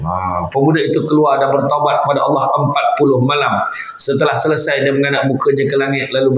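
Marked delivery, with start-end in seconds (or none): none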